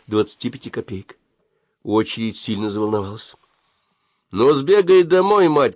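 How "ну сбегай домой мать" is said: higher, louder, slow yell from the queue; crowd shout. A narrator's reading. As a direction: shout it across the shop. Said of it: "кричали одни".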